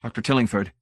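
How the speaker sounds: ordinary delivery, nothing marked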